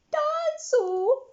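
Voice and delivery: put-on high, slow wailing toddler; toddler sobbing. A narrator's reading. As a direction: wail the word like a little boy. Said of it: "sobbed, sobbed George".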